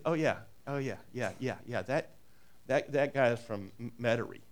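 low, soft mock local knowingly placing a neighbour; hushed recognition. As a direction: murmur it like spotting a hometown accent. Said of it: "what they're saying is".

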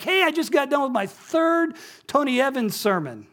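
high-pitched imitation of an enthusiastic neighbor; eager announcement. Neighbor telling the jogging pastor his news, eager announcement. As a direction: burst out with proud news.

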